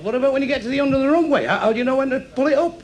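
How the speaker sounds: high, anxious passenger; acted high-pitched disbelief; alarmed questioning. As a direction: fire off the question in rising alarm. Alarmed passenger questions blind pilot.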